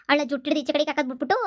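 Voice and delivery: usual speaking voice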